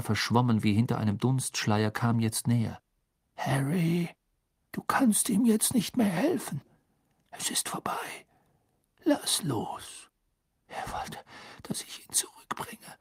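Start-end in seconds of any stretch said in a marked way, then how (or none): 3.3-10.1 hushed, breathy, soothing voice; gentle urging. A narrator's reading. Dumbledore urging Harry to let Cedric go, gentle urging. 10.7-13.0 faint, whispered murmur. A narrator's reading. Harry explaining Cedric's wish to Dumbledore, murmur. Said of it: "murmelte Harry".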